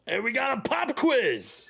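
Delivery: high, slow shouted promo; wrestler entrance shout. Retold or imitated. Wrestler coming out to the crowd, high shout. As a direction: bellow it to the crowd.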